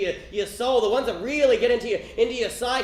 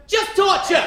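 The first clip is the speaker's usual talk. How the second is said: Just torture shouted, higher and louder; mock shout; 7 semitones higher, 4 dB louder, slower than usual. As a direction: bark out torture as a mock yell. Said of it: "And she said".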